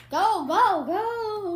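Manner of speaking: loud, high urging chant; cheering. Real, not acted. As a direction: push her on, go go go. Urging friend to eat.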